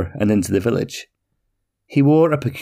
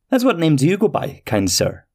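high, polite little traveller asking; curious little man; 6 semitones higher than usual. Acted. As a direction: ask kindly, lifting high on the question. Petrie asks the name.

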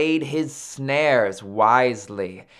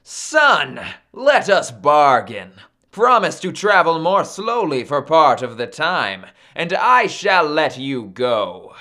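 loud, resolute captor's voice; firm bargaining; 5 dB louder than usual. Acted. Maui offers captive Sun a bargain, loud and firm. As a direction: project the offer with authority.

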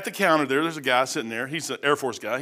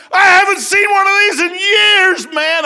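much higher and louder, slow excited shout; imitated loud veteran; 11 dB louder, slower than usual. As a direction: shout it like a gruff old airman thrilled by a tract. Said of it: "this is the way he's talking".

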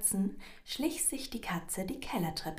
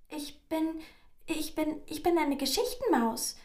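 high, stammering mouse voice; hasty mouse cry; 9 semitones higher, 4 dB louder than usual. A narrator's reading. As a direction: blurt it out as a cornered mouse. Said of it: "rief die Maus rasch".

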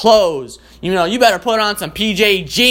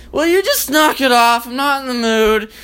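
higher, snappy mock irritation; irritated partner; 6 semitones higher than usual. Half-asleep partner snapping at the romantic guy. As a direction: push him off, fed up.

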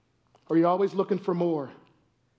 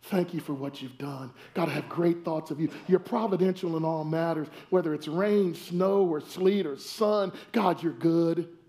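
quiet, unhurried morning thanksgiving; grateful prayer; 5 semitones lower, 3 dB quieter than usual. Retold or imitated. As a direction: pray gently, content with any weather. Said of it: "How about just waking up saying".

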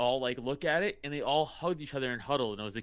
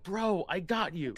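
mock orangutan helper; 7 semitones higher, 3 dB louder, slower than usual. Orangutan voiced helping scientist.

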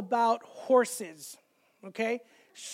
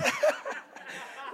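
real laugh, high and airy; high breathy laugh; 5 dB quieter than usual. Real, not acted. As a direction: burst into a high, breathless giggle. Laughing at daughter's lyric.

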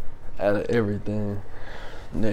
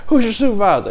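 high, louder imitated complaint; mock indignant demand; 11 semitones higher, 5 dB louder, faster than usual. Civilian demanding a supervisor, mock indignation.

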